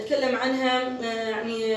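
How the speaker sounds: ordinary delivery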